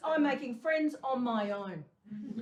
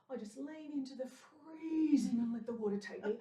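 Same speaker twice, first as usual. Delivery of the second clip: usual speaking voice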